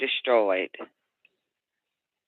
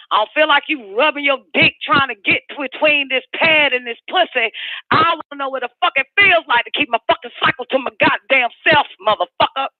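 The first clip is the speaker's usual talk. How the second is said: high, fast furious outburst; venting raw anger at her partner; heated rant; 12 semitones higher than usual. Real, not acted. Ranting at her partner about her body, high and furious.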